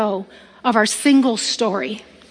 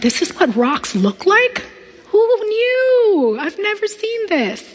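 high, awed gasp of discovery; mesmerized wonder; 8 semitones higher, slower than usual. Retold or imitated. Speaker seeing the crystal garden, awestruck. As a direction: marvel at the crystals inside. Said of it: "I am mesmerized".

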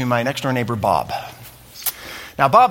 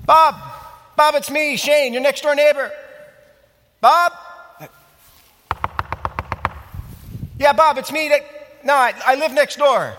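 calling out; 8 semitones higher than usual. Acted. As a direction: raise the voice to call through the door. Calling to Bob at his door.

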